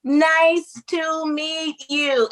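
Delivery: high, very slow mock-careful nice to meet you; slow over-articulated greeting. Girls still talking down to her, slow and exaggerated. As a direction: say it as if she can't understand. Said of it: "they still said".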